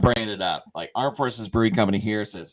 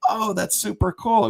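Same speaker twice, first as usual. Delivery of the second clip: higher-pitched, delighted awe; delighted reaction; 11 semitones higher than usual. Retold or imitated. Retelling a veteran's response to the beer idea, delighted. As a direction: imitate a man lighting up at good news. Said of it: "oh, his eyes lit up".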